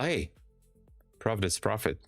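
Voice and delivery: usual speaking voice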